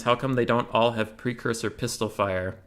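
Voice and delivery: ordinary talk, nothing marked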